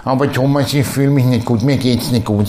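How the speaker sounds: low, mopey whine; mock whining. Parodying a moaning churchgoer, mopey whine. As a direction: whine it like a sulking complainer. Mocking a whiny depressed person.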